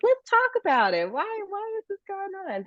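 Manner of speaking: put-on a bright parent voice coaxing a child; voicing a parent.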